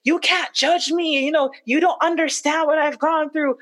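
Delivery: high-pitched, indignant self-defence; retold cursing out. Wife quoting husband's angry messages to her, retold cursing out. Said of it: "cursing me out".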